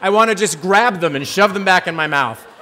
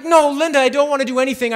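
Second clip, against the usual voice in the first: alarmed refusal; 8 semitones higher, 4 dB louder, faster than usual. Retold or imitated. Fending off her offer, retold.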